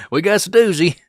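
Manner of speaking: high, loud hammed-up Kentucky hillbilly voice, laughing through it; hillbilly local accent. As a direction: shout it like an excited Kentucky local. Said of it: "full-blown hillbilly".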